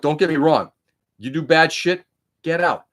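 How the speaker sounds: usual speaking voice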